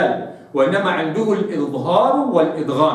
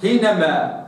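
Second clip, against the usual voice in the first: normal talking voice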